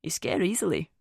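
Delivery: light Scottish accent with a hint of humour; gently teasing Scottish lilt; smooth Scottish accent. A narrator's reading. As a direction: play Finn as a smooth, amused Scotsman. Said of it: "His Scottish accent was smooth and held a hint of humour".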